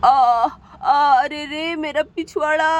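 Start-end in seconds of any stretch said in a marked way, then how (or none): 0.0-1.1 short loud groans of pain. Acted. Hurt father groaning at his sore backside. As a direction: groan as if your backside hurts.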